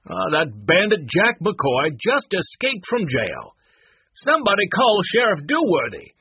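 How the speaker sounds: theatrical high-pitched outcry; alarmed Dapper Dallas. Acted. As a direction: sound the alarm to a Wild West crowd. Acts Dallas raising the alarm.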